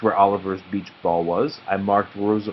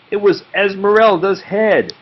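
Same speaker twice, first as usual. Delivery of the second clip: surprised reveal; 10 semitones higher, 8 dB louder than usual. A narrator's reading. Nate finding a head not a bag, surprised. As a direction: pitch jumps up on the reveal.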